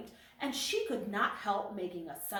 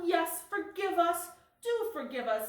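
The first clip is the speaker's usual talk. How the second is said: crying out together; 8 semitones higher, 4 dB louder than usual. A narrator's reading. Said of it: "they all cried out together".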